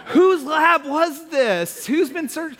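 baffled outburst. Retold or imitated. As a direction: retell it in mock disbelief. Retells his baffled childhood reaction.

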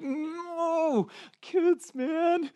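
squeaky defensive denial, pitch jumped way up; high-pitched protest. Retold or imitated. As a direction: protest high and fast like caught out. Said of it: "I'm like".

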